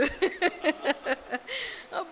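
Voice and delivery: long, soft run of giggles; helpless little laugh at own joke; amused laughter. Real, not acted. Amused at own remark about son.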